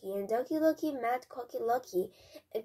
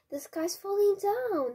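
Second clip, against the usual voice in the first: high, raised storyteller's yell; 8 semitones higher, 4 dB louder than usual. A narrator's reading. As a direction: yell it out in alarm, pitched well up. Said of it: "he yelled".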